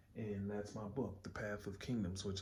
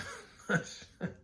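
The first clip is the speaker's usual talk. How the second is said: airy laugh; 10 semitones higher than usual. Real, not acted. Laughing at the comic.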